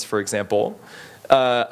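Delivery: ordinary talk, nothing marked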